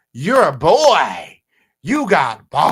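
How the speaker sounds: slow, loud sneering diss; mock diss delivery. Quoting the rapper's diss to chat, slow loud mockery. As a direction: drag it out loud and mocking. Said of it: "He goes on to say".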